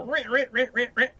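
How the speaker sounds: high-pitched repeated scraping noise; playful squeaky scrub sound effect; imitated swab scraping. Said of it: "they stick it way up there and go".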